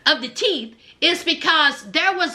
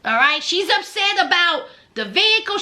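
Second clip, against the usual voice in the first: ordinary talk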